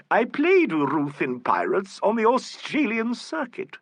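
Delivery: high, slow theatrical boast; grand old actress. A narrator's reading. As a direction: savour the memory like a stage diva. Old actress proudly recalls her role.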